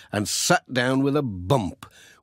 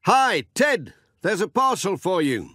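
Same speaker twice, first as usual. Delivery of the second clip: shouting to Ted; 7 semitones higher than usual. A narrator's reading. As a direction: call out to Ted on the hillside. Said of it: "He stopped and shouted to Ted".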